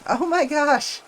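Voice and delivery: sudden loud gasp of surprise; surprised. Real, not acted. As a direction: blurt it out, caught off guard. Sudden raid appears.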